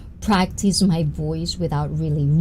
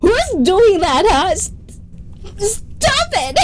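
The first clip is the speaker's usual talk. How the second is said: much higher, slow pleading through laughter; giggly protest; 12 semitones higher, slower than usual. Real, not acted. Streamer begging teasing viewers to stop, giggling high. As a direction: beg them to stop while laughing.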